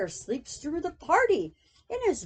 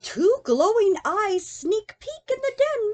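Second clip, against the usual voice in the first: usual speaking voice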